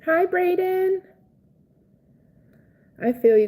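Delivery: ordinary talk, nothing marked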